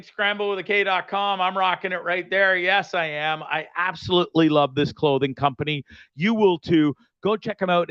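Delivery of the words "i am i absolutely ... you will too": ordinary delivery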